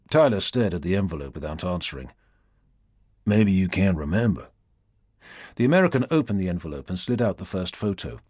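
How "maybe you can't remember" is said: languid Southern drawl. A narrator's reading. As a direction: purr it like a Southern plantation owner. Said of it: "languid southern drawl of a plantation owner".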